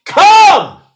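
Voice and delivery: loud, high cry to heaven; yelled call. Acted. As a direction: yell it out to God. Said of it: "yells out to Yahuwah".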